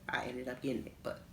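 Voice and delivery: a quick whisper to the listeners; whispered aside. Real, not acted. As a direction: drop to a secret whisper. Whispered aside about the ring.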